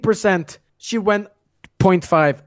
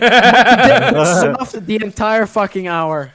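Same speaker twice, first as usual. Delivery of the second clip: laughing complaint; 4 dB louder, slower than usual. Real, not acted. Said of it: "laughing complaint".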